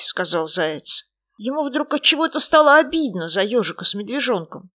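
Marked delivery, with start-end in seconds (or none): none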